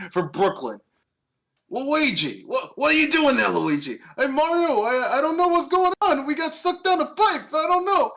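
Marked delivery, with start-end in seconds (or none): 1.7-4.0 hammed-up New York Italian Mario, higher; calling to his brother, Brooklyn style. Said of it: "a New York Italian guy to voice". 4.2-8.1 much higher, whiny New York Luigi; lost brother fretting about the pipe; panicky Luigi. Retold or imitated. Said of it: "a New York Italian guy to voice".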